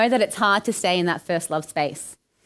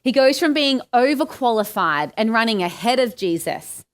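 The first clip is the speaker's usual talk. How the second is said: ordinary talk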